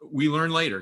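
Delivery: usual speaking voice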